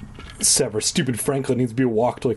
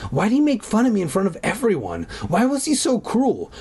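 whiny indignant; 8 semitones higher than usual. Acted. Angry whining about teacher.

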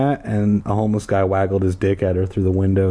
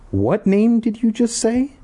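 put-on high boy voice; 12 semitones higher than usual. Paul voiced higher.

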